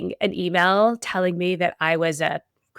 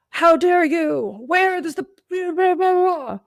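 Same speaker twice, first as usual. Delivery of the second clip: hammed-up indignation, pitched way up, dissolving into blahs; mock angry politician; 10 semitones higher, 4 dB louder than usual. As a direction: parody a grandstanding politician's angry soundbite. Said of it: "in a very angry voice".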